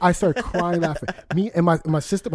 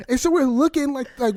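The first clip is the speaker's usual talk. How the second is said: ordinary talk, nothing marked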